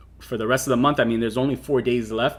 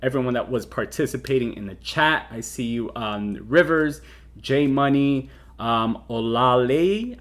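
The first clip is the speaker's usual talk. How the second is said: ordinary talk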